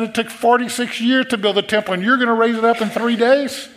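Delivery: can you believe this man; incredulous. Acted. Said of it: "they were incredulous".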